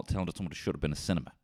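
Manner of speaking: ordinary talk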